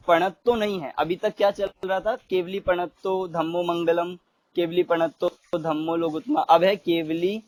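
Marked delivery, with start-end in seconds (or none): none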